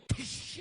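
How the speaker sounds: sharp voiceless hissed strike; sudden spat "tsh" sound; snake strike noise. Retold or imitated. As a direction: imitate a snake lunging with a hiss. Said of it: "you see the snake go".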